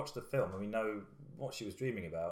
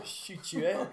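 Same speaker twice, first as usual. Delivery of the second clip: higher-pitched echo of a South African parody; mimicked South African accent; 9 semitones higher, 4 dB louder, slower than usual. Co-host echoing the parody, mock South African accent. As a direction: copy the silly South African accent back. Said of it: "Nobody talks like that".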